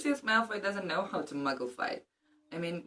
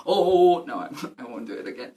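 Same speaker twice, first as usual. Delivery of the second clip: laughing; 6 dB louder than usual. Real, not acted. Laughing after singing.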